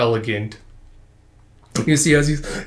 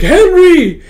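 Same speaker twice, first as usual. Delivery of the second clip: alarmed cry; 10 dB louder than usual. Acted. Girl cries out his name.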